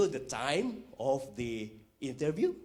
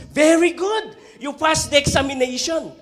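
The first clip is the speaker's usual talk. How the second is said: much higher, louder triumphant officer; excited congratulation; 8 semitones higher, 10 dB louder than usual. Retold or imitated. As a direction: announce the pass with glee.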